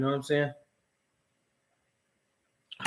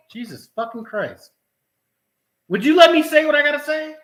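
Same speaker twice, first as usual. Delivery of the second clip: higher, louder exasperated snap; exasperated outburst; 11 semitones higher, 7 dB louder than usual. Real, not acted. Snapping at someone off-camera who keeps interrupting, exasperated and loud. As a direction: vent at being cut off again.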